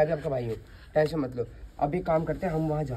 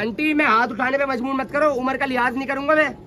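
raised, higher warning voice; angry threat; 7 semitones higher than usual. Acted. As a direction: warn her hard before she strikes. Threatening the aunt. (Aunty को गुस्से में ऊँची आवाज़ में धमकी)

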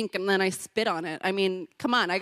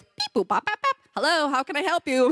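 imitated phone beeps; 6 semitones higher than usual. Imitating hotel phone system.

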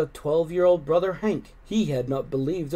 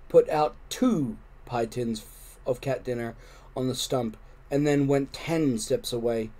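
ordinary delivery

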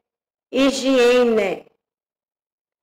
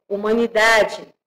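ordinary talk, nothing marked

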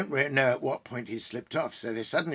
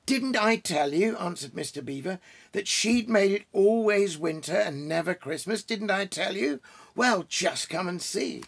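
excited; 8 semitones higher, 4 dB louder than usual. Acted. Excited beaver voice.

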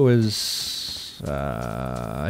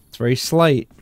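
ordinary talk, nothing marked

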